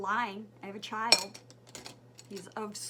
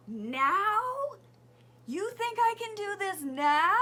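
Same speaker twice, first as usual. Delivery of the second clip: loud, high exasperated yell; retold flipping out; 9 semitones higher, 10 dB louder, slower than usual. Mock yell of frustration at the mailbox. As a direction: mom yelling at the mailboxes about the summons. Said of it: "flipping out".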